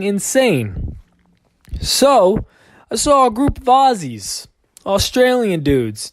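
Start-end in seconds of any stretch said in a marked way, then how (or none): none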